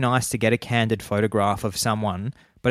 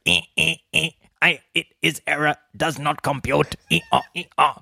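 higher, stuttering glitchy machine voice; old speech synthesizer; 5 semitones higher than usual. Retold or imitated. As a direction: sputter like a broken computer. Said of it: "I reckon they'd go".

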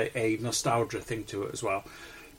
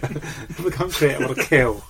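laughing; 2 semitones higher, 6 dB louder, slower than usual. Retold or imitated. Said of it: "That's it".

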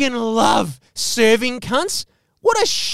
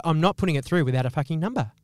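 stern refusal; 5 semitones lower, 4 dB quieter, faster than usual. Retold or imitated. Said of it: "she was real stern on it".

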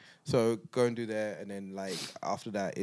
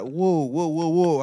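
alarmed whoa; 7 semitones higher, 11 dB louder, slower than usual. Retold or imitated. Panicking at needle.

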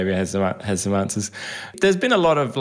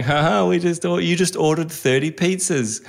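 higher-pitched mock gloating; gleeful prankster; 6 semitones higher than usual. Company to customers it has pranked, mock gleeful. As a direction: crow it like a prankster.

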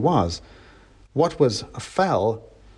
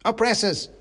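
mock fighting shout; 11 semitones higher than usual. A narrator's reading. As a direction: hurl it out as the little soldier. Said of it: "he shouted".

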